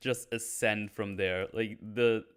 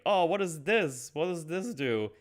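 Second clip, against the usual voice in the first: put-on childlike wonder; 6 semitones higher than usual. Curious Pinocchio.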